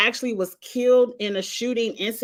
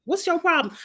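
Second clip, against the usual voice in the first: quoting Kenya; 6 semitones higher than usual. Retold or imitated. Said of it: "She said".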